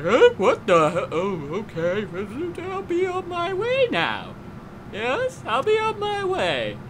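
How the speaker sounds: high mock enemy voice. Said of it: "And that guy's like".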